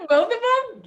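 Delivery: high and loud, shocked; disbelieving shout. Real, not acted. Surprised protest.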